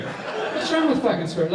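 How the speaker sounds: a laughing echo of the protest; laughing repeat. Retold or imitated. Storyteller repeating the player's line, laughing.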